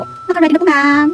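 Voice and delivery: slow, loud and bright "ready na po"; eager polite maid. Retold or imitated. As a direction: answer the boss proudly. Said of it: "sabi ko sa kanya". (masunuring sagot sa amo, mabagal at malakas)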